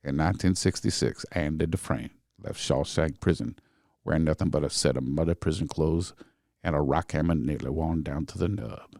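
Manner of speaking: low, measured film-narrator impression; deep, unhurried storytelling voice, dropping at each phrase; Morgan Freeman.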